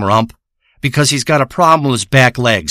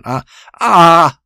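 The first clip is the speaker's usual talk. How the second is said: short pained moaning groans; pained moans; 2 semitones higher than usual. Retold or imitated. Mimicking the moans heard from the boat, pained groans. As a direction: groan like a pulled back. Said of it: "It was louder than that".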